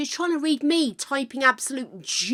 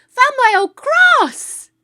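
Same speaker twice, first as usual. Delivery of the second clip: high, loud, drawn-out cry of discovery; excited announcement; 12 dB louder, much slower than usual. Real, not acted. Announcing a treasured find to viewers, excited shout.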